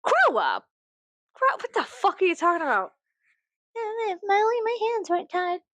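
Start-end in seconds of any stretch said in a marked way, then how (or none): 3.8-5.6 high, mocking helpless whine. Mocking the one percent's excuses, high fake-helpless whine. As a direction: sneer it in a falsely helpless voice.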